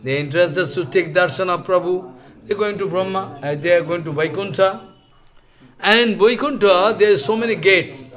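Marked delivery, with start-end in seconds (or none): none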